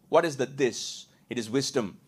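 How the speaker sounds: usual speaking voice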